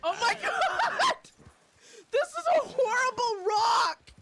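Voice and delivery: loud, high, wailing protest; frantic shout rising to a shriek; panicked yelling. Real, not acted. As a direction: yell it in alarmed exasperation. Scared and yelling in game.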